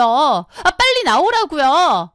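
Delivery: high, demanding sister-in-law voice; whiny sister-in-law. Acted. Sister-in-law nagging on the phone, high and demanding. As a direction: pester her to come out now.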